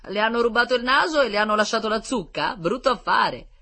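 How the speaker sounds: high, loud heckle from the crowd; mocking jeer. A narrator's reading. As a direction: taunt him loudly from the street. Laughing crowd jeers at victim.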